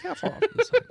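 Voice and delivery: high mock question. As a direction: tease the player about distance. GM teasing high voice.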